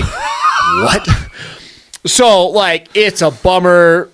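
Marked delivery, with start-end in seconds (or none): 0.0-1.1 a sudden, much higher shout of disbelief; stunned shout at the camera guy; shocked yell. Retold or imitated. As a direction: yell it like the footage is gone. Camera guy admits he didn't record.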